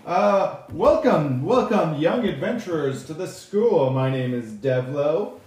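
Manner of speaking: loud, high welcome address; high elf host opening an assembly; high elf speaker. Acted. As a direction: project a stagey welcome to the whole hall.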